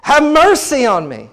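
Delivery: loud, high, drawn-out cry. Acted. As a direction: persistent shout begging for mercy. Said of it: "he cried out all the more".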